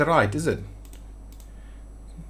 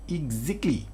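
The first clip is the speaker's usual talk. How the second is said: a quick South African "Exactly"; playful South African accent; 3 semitones higher, 5 dB quieter than usual. Pretend. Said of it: "my best South African accent".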